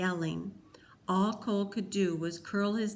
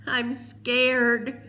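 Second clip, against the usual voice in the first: higher, louder tearful cry; 5 semitones higher, 8 dB louder than usual. A narrator's reading. Peter crying to Cole, loud tearful admission. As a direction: break down and admit it. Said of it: "cried Peter".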